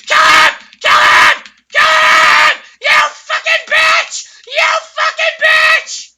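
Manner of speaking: high, loud screamed commands; frantic screaming. Real, not acted. Gamer screaming at teammates to kill enemy, frantic yell. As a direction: scream it mid-fight. Frantic mid-fight yelling.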